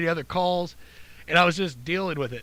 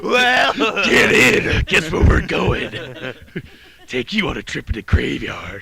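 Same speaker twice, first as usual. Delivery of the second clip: slow, loud creepy cabbie voice; hammed-up sinister slow invitation; unbalanced cabbie; 5 semitones lower, 8 dB louder, slower than usual. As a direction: play a deranged driver, dragging it out. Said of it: "unbalanced persona".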